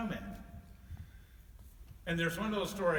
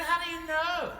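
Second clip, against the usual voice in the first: high, louder, astonished; astonished question; 12 semitones higher, 5 dB louder than usual. Acted. Santa asking the snowman, high and astonished. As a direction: ask in wide-eyed disbelief.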